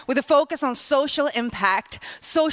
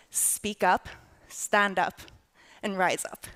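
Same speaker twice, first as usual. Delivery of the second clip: softer, lower, trailing slogan; 5 semitones lower, 6 dB quieter than usual. Real, not acted. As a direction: let the slogan fall softly. Slogan repeated softly.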